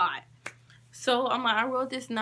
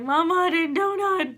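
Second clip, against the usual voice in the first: higher, louder tearful wail; crying to mama; 7 semitones higher, 4 dB louder than usual. Retold or imitated. Daughter crying to her mama on the phone, tearful wail. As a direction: cry out your innocence. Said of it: "I started crying like".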